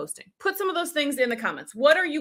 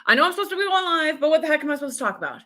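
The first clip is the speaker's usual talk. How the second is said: higher, rushed exasperated wondering; frustrated; 4 semitones higher, much faster than usual. Acted. Viewer who knows they should be live, frustrated. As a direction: fret aloud in a quick, raised voice. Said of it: "maybe you're even frustrated".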